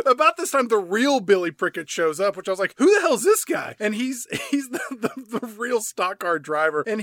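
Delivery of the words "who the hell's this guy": high, louder mock disbelief; baffled outburst. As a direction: blurt it out in mock bewilderment. Retelling own baffled reaction.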